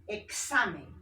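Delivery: Examen with the middle SA hit, ek-SA-men; stress on SA. Shown as a demonstration. Teacher giving an example, Examen stressed on middle SA. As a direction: push the XA in Examen. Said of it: "en sa".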